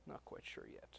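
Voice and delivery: whispering to himself; whispered aside. Real, not acted. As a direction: drop to a whisper, unsure. Muttered aside.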